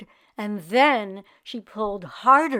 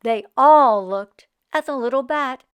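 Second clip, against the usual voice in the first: plain reading voice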